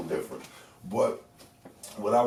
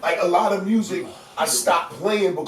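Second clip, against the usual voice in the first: excited; 13 semitones higher, 11 dB louder than usual. Real, not acted. Excited about playing Luther.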